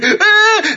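very high, loud mock shriek; child's scream. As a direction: squeal like a frightened little girl. Imitating daughter screaming on ride.

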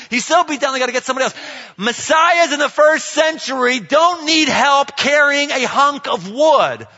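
loud, high, incredulous preacher; impassioned preaching. Real, not acted. Preacher questioning why the Messiah looks weak, loud and worked up. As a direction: build a heated rhetorical challenge at full voice.

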